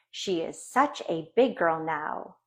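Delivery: plain reading voice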